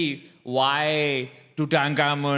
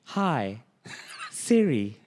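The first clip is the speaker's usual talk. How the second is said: Siri voice; 5 semitones lower, much slower than usual. Pretend. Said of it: "Oh, that's Siri".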